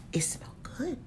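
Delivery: whispered quote of a friend; whispered retelling of his approval; quoting whisper. Retold or imitated. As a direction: whisper his line, impressed. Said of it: "He like".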